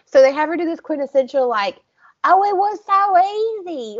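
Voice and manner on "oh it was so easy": high, exaggerated Southern accent; high-pitched imitation Southern accent; mock Southern accent. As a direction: put on a hammed-up Southern belle accent. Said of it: "when they do Southern accents".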